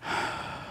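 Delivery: soft, unvoiced letting-go sigh; relieved sigh. Shown as a demonstration. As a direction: breathe out all the worry. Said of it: "you go".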